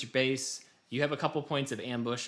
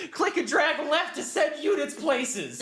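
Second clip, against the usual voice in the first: mock shouting tutorial; 5 dB louder than usual. Host mimics the tutorial yelling controls at her, loud and high. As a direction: bark orders like an overexcited game tutorial. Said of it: "it was just shouting things at me".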